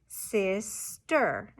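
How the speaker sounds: word split slowly into two separate syllables; chopped syllables. Shown as a demonstration. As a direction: say "sister" syllable by syllable, with a gap before "ster". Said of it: "blend those syllables together to say the word".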